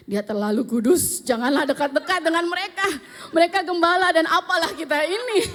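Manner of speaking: loud, high, hammed-up deference; mock awe. Retelling how they shied from the pastor couple, mock awe. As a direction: exaggerate the humility, building toward laughter.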